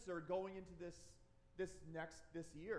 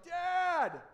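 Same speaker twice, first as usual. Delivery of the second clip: put-on loud, high kid's yell for dad; imitated yelling; 8 semitones higher, 12 dB louder than usual. Three-year-old son pounding on the bathroom door, yelling. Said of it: "He keeps yelling".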